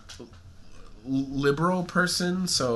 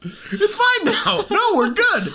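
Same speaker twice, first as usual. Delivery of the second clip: laughing; slower than usual. Real, not acted. Laughing through sniffles.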